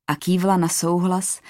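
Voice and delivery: ordinary delivery, nothing marked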